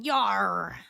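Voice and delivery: loud, drawn-out pirate "yar"; pirate accent. Shown as a demonstration. Streamer demoing a favorite accent, loud pirate voice. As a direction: growl it out like a pirate. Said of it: "talking in a pirate accent".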